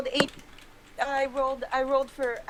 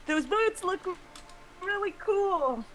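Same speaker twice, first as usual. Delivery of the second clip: tiny voice; 6 semitones higher than usual. Acted. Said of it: "a tiny voice say".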